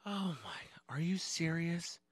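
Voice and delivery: slow, breath-held murmur; hushed disbelief. Retold or imitated. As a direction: draw it out in quiet disbelief. Principal reacts in disbelief.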